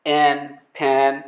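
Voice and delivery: एन, फैन said very slowly and clearly; teacher reading एन, फैन slowly; slow model reading. Shown as a demonstration. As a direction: drill एन, फैन at a crawl. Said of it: "इस तरह से pronunciation करते हैं".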